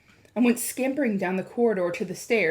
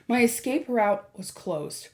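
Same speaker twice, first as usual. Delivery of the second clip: usual speaking voice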